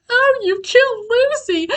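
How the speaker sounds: high, sharp mock outrage; mock accusing Mina. Mina finding out Dracula killed Lucy, mock outrage. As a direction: squeal it like a shocked heroine. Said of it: "She's like".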